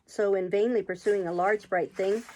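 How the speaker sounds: ordinary delivery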